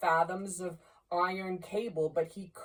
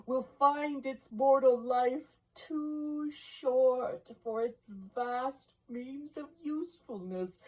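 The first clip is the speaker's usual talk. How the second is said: higher-pitched, impassioned ghostly oration; lamenting phantom; 6 semitones higher, 4 dB louder than usual. Acted. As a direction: rise steadily higher into anguished regret.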